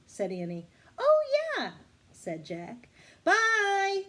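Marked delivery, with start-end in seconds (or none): 1.0-1.7 high, loud surprised oh; sudden surprised realization. Acted. Jack suddenly remembering the couple, surprised. 3.2-4.0 high, loud farewell call. Acted. Annie calling goodbye to the distant couple, loud. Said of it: "Annie called to them".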